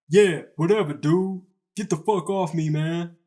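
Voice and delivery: acted angry retort. Acted retort from pinned opponent.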